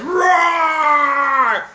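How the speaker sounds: loud playful tiger roar. Pretend. Tiger roaring at Pharaoh, loud and playful. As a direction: roar like a big cat.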